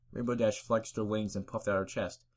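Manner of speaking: ordinary delivery, nothing marked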